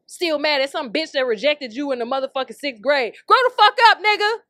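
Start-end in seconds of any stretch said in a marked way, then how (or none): 3.3-4.4 loud, high furious yell; furious shout. Real, not acted. Telling the angry commenter off, furious shout. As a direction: blast it at him like a final blow.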